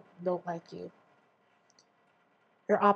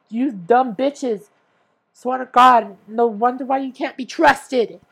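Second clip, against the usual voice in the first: slow, much louder and higher yelled insults; furious shout; 4 semitones higher, 13 dB louder, slower than usual. Real, not acted. Ranting at the podcast company, shouting angrily. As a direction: yell it right at them, fed up.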